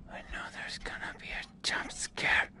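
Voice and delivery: nervous whisper; scared horror game streamer. Real, not acted. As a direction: breathe it, afraid of a jump scare.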